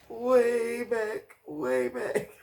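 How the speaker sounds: very high, drawn-out catchphrase; shocked exclamation. Real, not acted. Recapper reacting to the pushing reveal, shocked. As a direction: squeal it twice in disbelief.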